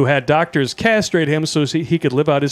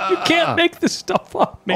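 high, through laughter; laughing. Real, not acted. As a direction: say it while cracking up. Laughing at story.

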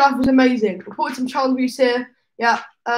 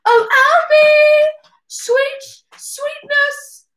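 much higher, slow, sugary calling; sweet parental call; 14 semitones higher, 6 dB louder, much slower than usual. Retold or imitated. Parents calling their son from upstairs, high and sugary. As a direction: coo down the stairs to a little darling. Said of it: "a sweet call from up the stairs".

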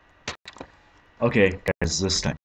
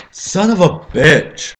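drawn-out furious yell, much higher and louder; real rage outburst; 11 semitones higher, 12 dB louder, slower than usual. Real, not acted. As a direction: explode at the game. Rage at failing level.

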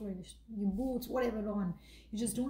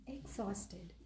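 ordinary talk, nothing marked

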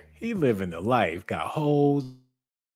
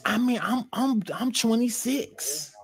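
put-on high, girlish voice; high young girl voice; 11 semitones higher, 6 dB louder than usual. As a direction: squeak it like a little girl.

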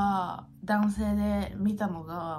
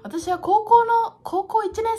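emphatic; 12 semitones higher, 8 dB louder, slower than usual. Real, not acted. Sudden loud emphasis.

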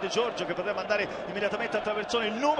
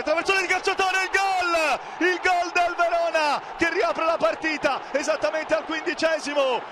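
breathless italian goal commentary; 3 semitones higher than usual. Real, not acted. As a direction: scream the goal with joy. Verona goal, shouted call.

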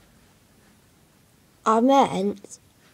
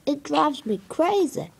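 normal talking voice

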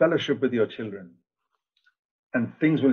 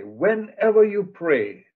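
slow emphatic repeat; 6 semitones higher, much slower than usual. Acted. Repeats same phrase slower.

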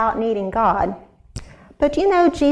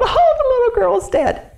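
high, loud mourning wail again; 14 semitones higher, 4 dB louder than usual. Acted. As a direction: keep wailing in grief. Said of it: "they wailed".

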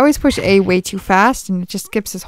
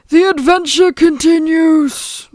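high, loud, drawn-out proclamation; hammed-up adventure title voice; mock grand announcer; 8 semitones higher, 6 dB louder, slower than usual. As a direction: announce it slowly with playful grandeur. Performs title line in put-on voice.

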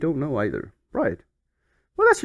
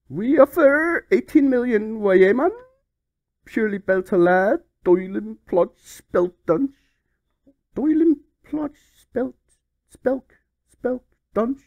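high, loud, slow slurred Geordie voice; drunk Geordie; 9 semitones higher, 5 dB louder than usual. Pretend. Reading the Newcastle offer as a loud drunk Geordie. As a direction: slur out nonsense like a drunk Newcastle lad. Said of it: "He sounds pretty drunk".

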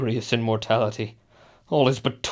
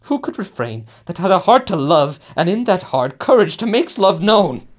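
high, loud impassioned plea; carried away by passion; loud, high impassioned protest; passionate defence; 7 semitones higher, 5 dB louder than usual. Acted. Justifying the killing passionately.

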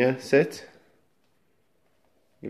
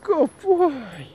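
high squeaky praise; squeaky praising a dog. Real, not acted.

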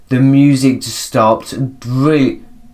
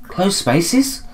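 higher voice, rising question; puzzled high echo; 7 semitones higher than usual. Acted. Character echoing the ghost, puzzled high question. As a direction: ask it timidly, pitched up.

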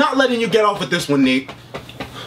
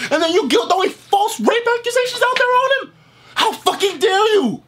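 outraged rant; 10 semitones higher than usual. Real, not acted. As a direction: lash out at him in disbelief. Ranting at Nate over accusation.

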